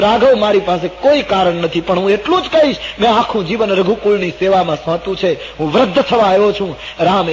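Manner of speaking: impassioned, high-pitched testimony; grave earnest appeal. Acted. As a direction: declare it with urgency. Sumant earnestly warns troubled Ram.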